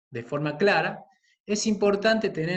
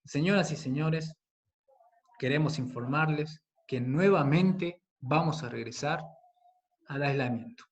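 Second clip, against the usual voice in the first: subdued, sorrowful announcement with long pauses; sad, pained timbre; 3 dB quieter than usual. Shown as a demonstration. Teacher demonstrates announcing a return to isolation, sad and pained. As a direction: break the news gravely, sinking at the end. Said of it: "expresar tristeza, dolor, por ejemplo".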